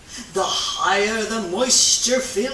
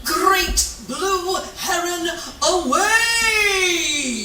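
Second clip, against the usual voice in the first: heroic exit cry; 7 semitones higher, much slower than usual. Acted. The heron flies off after the experiment, heroic shout. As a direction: shout it as you take flight.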